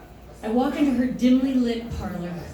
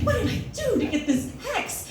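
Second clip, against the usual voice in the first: higher, rising frantic self-questioning; higher, panicky pleading with herself about the hex; frantic inner panic; 6 semitones higher than usual. Retold or imitated. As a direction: spiral into panicked guesses. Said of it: "all I could think about was".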